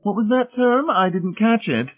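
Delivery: very high-pitched matron voice; puzzled matron. A narrator's reading. Mrs Pritchett asking about an unfamiliar word, high-pitched and puzzled. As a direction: ask in a high, fussy character voice.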